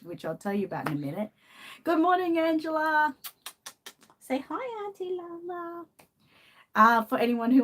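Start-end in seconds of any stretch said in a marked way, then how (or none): 1.8-5.9 high cooing voice for the pet, much higher; pet-talk voice. Real, not acted. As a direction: coo a hello on the pet's behalf. Making pet greet viewers.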